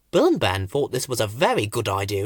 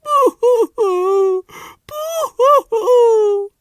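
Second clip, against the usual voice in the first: very high, slow wailing boo-hoo; hammed-up sob; sobbing; 7 dB louder, much slower than usual. A narrator's reading. An unseen flower crying next door, high wailing sobs. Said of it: "heard someone sobbing".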